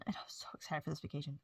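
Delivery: soft whisper; whispered, breathy aside. Real, not acted. Whispers vacation plans.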